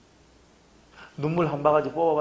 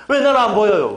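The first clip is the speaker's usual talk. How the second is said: higher, louder whining demand; whiny complaint; 6 semitones higher, 9 dB louder than usual. Retold or imitated. Believer whining at God, higher and louder. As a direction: nag like a spoiled believer.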